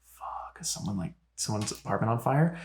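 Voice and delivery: very soft, worried muttering to self; hushed worry. Retold or imitated. Resident hearing the whole building beep, hushed worry. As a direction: think it aloud under the breath.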